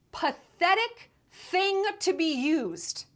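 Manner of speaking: drawn-out contemptuous verdict; scathing contempt. Real, not acted. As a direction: draw out each word with scorn.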